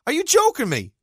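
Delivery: high, loud disbelief; incredulous. Real, not acted. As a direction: fire it back as if it can't be true. Incredulous at claim about brothers.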